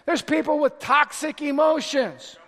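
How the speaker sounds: usual speaking voice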